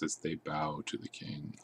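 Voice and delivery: unvoiced soft words; whispered. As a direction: breathe it out in a whisper. Whispered ending of line.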